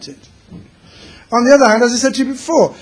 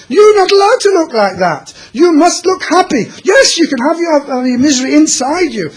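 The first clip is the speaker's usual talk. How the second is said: put-on raised, higher voice scolding the boy; stern rebuke; 7 semitones higher, 4 dB louder than usual. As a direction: tell him off loudly, half-shouting. Rabbi rebukes miserable boy.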